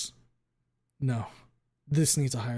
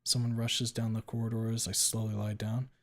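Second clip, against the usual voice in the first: ordinary delivery, nothing marked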